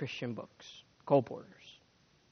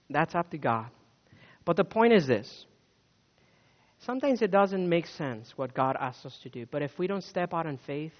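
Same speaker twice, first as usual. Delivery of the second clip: normal talking voice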